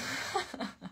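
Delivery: short soft chuckle; laugh. Real, not acted. Streamer amused by saying Santa Ana, soft chuckle. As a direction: let out a brief run of chuckles.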